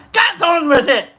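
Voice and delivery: mock impatient yell. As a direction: raise it into a mock holler. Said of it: "you made fun of me".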